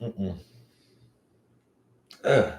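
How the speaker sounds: normal talking voice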